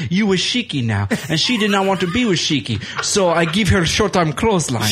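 hammed-up Sheik accent, aggrieved and insistent; Iron Sheik impression. Sheik explaining to Marty about the girl, mock Sheik accent. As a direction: plead your case in a badly faked Sheik accent. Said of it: "I have a terrible Sheik impersonation".

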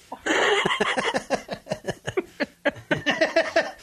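long high laughing fit. Real, not acted. Host laughing at his own jab at Kieran, long high laugh. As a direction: crack up at your own joke.

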